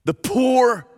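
POOR thrown up high and held; high, exclaimed stress on poor; emphatic. Shown as a demonstration. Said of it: "They're meant to be emphatic".

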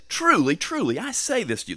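"Truly, truly" pitched high and hit hard; emphatic. Retold or imitated. Preacher quoting Jesus' cue that truth follows, emphatic. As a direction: proclaim "Truly, truly" as a cue to listen. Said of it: "what I'm about to say is emphatically".

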